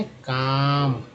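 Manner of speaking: काम drawn out, no L sound; काम said very slowly; slow clear word. Shown as a demonstration. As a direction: stretch काम, keep the L silent. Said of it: "एलएम में एल silent है".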